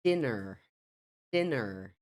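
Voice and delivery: American "Dinner" with a sounded final r; American pronunciation. Shown as a demonstration. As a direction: say Dinner as "DIN-er". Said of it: "Veja a diferença aí entre as duas variantes".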